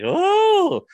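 very high, loud startled oh; shrill gasp of surprise. Retold or imitated. Old wife sees Henry the eighth, startled high oh. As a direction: yelp like an old lady seeing Henry. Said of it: "She's like".

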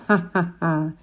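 cackling witch laugh; witch cackle. A narrator's reading. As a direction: burst out cackling. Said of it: "снова расхохоталась".

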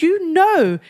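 high, insistent retold accusation; indignant retelling. Retold row with best friend.

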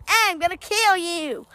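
Random Fact Joe voice. Acted. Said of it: "And I'm Random Fact Joe".

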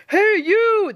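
high, loud bus-driver yell. A narrator's reading. Bus driver to the new girl, yelling. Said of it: "the bus driver yelled".

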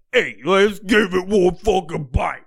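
mock New York pizza-guy voice; exaggerated, slow Guido drawl; Portnoy. Mocking a pizza reviewer about to take a bite, mock Guido voice. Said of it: "Jeff Portnoy, Portnoy pizza guy".

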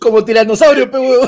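loud, laughing jab; laughing mockery. Real, not acted. Teasing a friend's little arm in the photo, loud and laughing. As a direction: blurt it out loud through laughter.

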